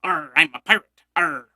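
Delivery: mock a half-hearted costume pirate. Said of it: "put on a hat and an eye patch and go".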